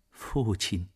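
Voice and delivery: usual speaking voice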